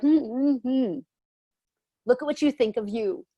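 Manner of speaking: teasing mock hum, then a smug jab; joking, sarcastic. Beautiful people mocking the self-judger, sarcastic and teasing. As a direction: needle them, playfully sarcastic. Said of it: "I may say that in a joking, sarcastic way".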